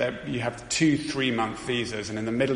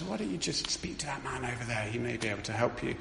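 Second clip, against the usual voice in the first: much softer, hushed aside; whispered tip; 8 dB quieter than usual. Retold or imitated. Stranger at the airport quietly tipping off the family, hushed. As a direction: lean in and murmur the advice. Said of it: "she just whispered into our ears".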